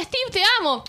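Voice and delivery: put-on drunk declaration. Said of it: "el otro".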